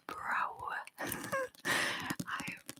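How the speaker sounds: airy laugh under her breath; whispered laugh. Real, not acted.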